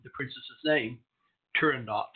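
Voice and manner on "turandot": Turandot with a final T sounded; T pronounced. Shown as a demonstration. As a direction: land the last T of Turandot. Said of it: "you do pronounce the T".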